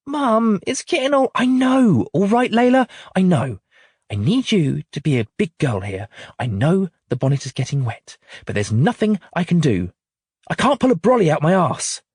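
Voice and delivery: the mum snaps at her daughter in the rain; exasperated mum. A narrator's reading. Mum loses patience with child.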